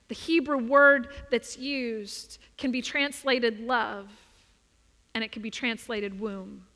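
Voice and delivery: usual speaking voice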